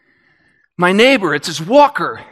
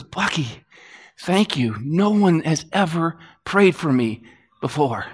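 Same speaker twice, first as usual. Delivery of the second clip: softer, choked-up gratitude; tearful thanks; 3 semitones lower, 5 dB quieter than usual. Retold or imitated. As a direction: thank him through tears, quietly. Neighbor in tears thanks him.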